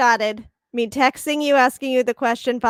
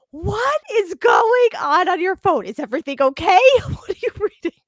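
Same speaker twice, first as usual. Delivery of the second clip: alarmed husband; 7 semitones higher, 4 dB louder than usual. Retold or imitated. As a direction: demand answers in alarm. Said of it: "he's like".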